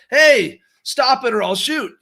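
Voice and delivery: loud, high mock warning shout; yelled threat. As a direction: yell it like an action-movie hero confronting thieves. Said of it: "yelled to the thieves to stop".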